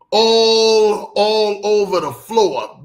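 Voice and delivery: ALL stretched long and very loud; emphatic exclamation. Real, not acted. Host recalling roaches in a daycare, loud drawn-out emphasis on ALL. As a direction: hit "All" hard and hold it, slow after. Stressing how many roaches there were.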